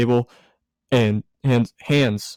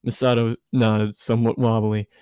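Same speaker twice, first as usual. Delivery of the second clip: usual speaking voice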